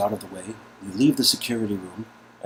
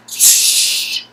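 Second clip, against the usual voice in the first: sharp shush; 11 dB louder than usual. Acted. Nadia hushes sharply.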